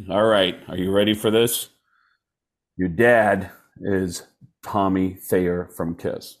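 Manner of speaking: slow, low, drawn-out announcement; mock solemn reveal. Tester revealing a kid's dad, mock solemn and slow. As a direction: break the news with weighty pauses. Acted DNA test result reveal.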